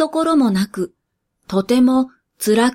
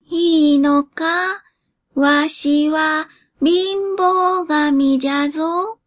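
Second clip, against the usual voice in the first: put-on slow, higher croaky old spirit asking in doubt; an ancient god checking, unsure; creaky wise old man; 5 semitones higher, slower than usual. Poverty god asks doubtfully.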